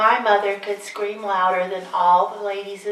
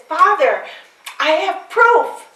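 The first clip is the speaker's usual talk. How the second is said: louder, higher, slow and urgent; eager proof; 9 semitones higher, 9 dB louder, slower than usual. Acted. Mary pleading with her father, loud and urgent. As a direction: burst out with it, desperate to be believed.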